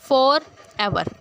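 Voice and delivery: "forever" with a gap after for; split into syllables. Shown as a demonstration. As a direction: break "forever" into for and ever. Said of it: "word के दो हिस्से हो रहे". ("forever" दो हिस्सों में तोड़कर)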